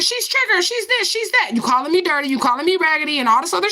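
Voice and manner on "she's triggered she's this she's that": high mocking voice of a commenter. Mocking what the commenter wrote, high voice. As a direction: sneer it back in a high taunting voice.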